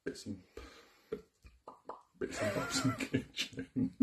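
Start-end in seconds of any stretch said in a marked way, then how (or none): 2.2-4.0 breaking into high-pitched giggles mid-sentence; laughing. Real, not acted. Host listing kit for the kitchen, cracking up laughing. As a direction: squeeze the words out through laughter.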